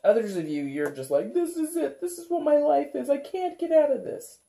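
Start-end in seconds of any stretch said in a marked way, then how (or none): 1.3-4.4 high, loud mock despair; despairing whine. Tarot reader voicing a hopeless viewer, high whiny despair. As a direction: whine that life is stuck for good.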